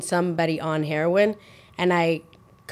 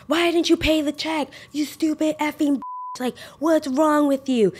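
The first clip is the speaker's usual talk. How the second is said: raised, higher-pitched retold scolding; mock angry yell; 8 semitones higher than usual. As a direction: act out a friend blowing up over the bill, half-serious. Friend yelling at friend.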